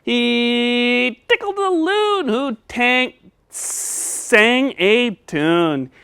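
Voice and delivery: slow, drawn-out reading. Shown as a demonstration. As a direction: read it slowly for the child. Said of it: "slow modeling of the line".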